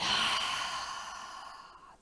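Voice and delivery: long audible sigh out through the mouth; big exhale, releasing all the air; letting-go sigh. Shown as a demonstration. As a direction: sigh it out, slowly fading. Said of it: "then sigh it out".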